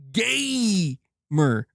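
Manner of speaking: "gamer" with a long first syllable; emphatic GAMER. Acted. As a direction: stretch the first syllable of "gamer", then drop. Repeats gamer with drawn-out stress.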